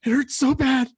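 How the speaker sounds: pleading with wife about the pain; mock pained pleading. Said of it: "I'm like".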